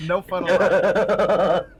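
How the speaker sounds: slow, loud, laughing mock scold; mock stern. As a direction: ham up the stern order through laughter. Repeats line, hammed up.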